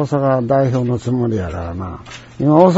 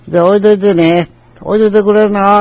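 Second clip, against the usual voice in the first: loud, high-pitched imitation; very loud customer; 6 semitones higher, 5 dB louder than usual. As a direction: call across the shop. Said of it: "ものすごい大きな声で".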